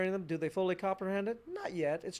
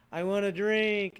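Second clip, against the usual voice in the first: slow, loud mock whine of a child; whiny child; 2 semitones higher, 6 dB louder, slower than usual. Imitating a child whining to parents for a drink. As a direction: drag it out like a thirsty kid whining. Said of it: "and they whine about it".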